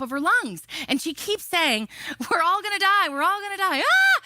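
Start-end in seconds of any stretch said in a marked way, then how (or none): 2.3-3.8 high-pitched mock hysteria, a bit louder; imitated panicked screaming. Screaming woman on a turbulent plane, mock panic. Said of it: "this lady just kept screaming". 3.8-4.2 loud, very high mock shriek; high panicked scream, acted out; imitated scream. Passenger screaming in turbulence, imitated shriek. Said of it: "She's screaming".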